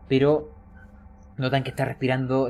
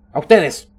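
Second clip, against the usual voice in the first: sharp order; 3 semitones higher, 11 dB louder than usual. Acted. Guard ordering players out.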